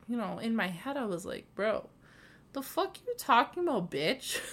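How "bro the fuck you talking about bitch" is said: aggressive inner retort. Retold or imitated. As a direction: fire it back like a tough retort. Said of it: "That's so aggressive".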